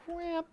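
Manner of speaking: high, sharp whap sound; mock whap. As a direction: sound effect of a hit. GM clears the wall, sound effect.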